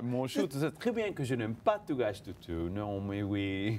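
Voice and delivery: playful refusal in French; French accent. Shown as a demonstration. Actor teasing interviewer who begged for French, in French accent. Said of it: "let me hear a little bit of French accent".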